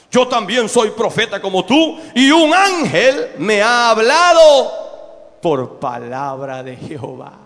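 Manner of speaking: loud, slow, hammed-up prophet voice; mock authoritative old prophet. Old prophet lying to the man of God, hammed-up authority. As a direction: puff up like a self-important elder. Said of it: "quiso agarrar voz más ronca".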